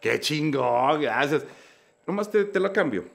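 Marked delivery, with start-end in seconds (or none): 0.0-1.4 slow, louder overjoyed relief; relieved gratitude. Retold or imitated. Speaker thanking the psychologist for agreeing with him, slow relief. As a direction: savour finally being understood.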